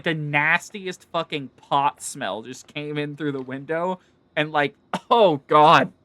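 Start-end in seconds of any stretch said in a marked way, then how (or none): 4.9-5.9 laughing. Real, not acted. Disgusted by smoke smell.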